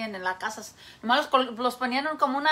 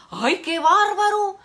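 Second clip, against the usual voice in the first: higher, louder, slow amazed parrot; the parrot gasping at Jesus' phone bill; 5 semitones higher, 6 dB louder, slower than usual. Acted. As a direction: drag out the shock like a cheeky parrot.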